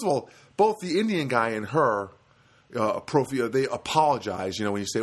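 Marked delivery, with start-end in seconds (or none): none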